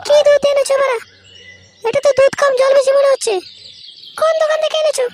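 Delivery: very high, piercing wife voice; scolding wife. Acted. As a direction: squeal your complaint at the husband. Wife scolds over watery milk. (বউয়ের খুব চড়া গলায় বকুনি)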